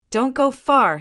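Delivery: plain reading voice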